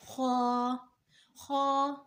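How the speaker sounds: خا as heavy throaty kh with rounded "aw"; thick pronunciation. Shown as a demonstration. Teacher modelling the letter for learners, خا thick and rounded. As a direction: hold خا long and full-mouthed. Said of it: "خا موٹا پڑھا جائے گا".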